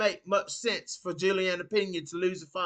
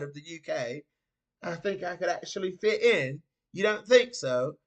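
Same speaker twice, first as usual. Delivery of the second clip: higher-pitched mock British accent; put-on British accent; 5 semitones higher, 4 dB louder than usual. Streamer claiming he'd blend in over in the UK, mock British accent. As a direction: play at being British, raised pitch.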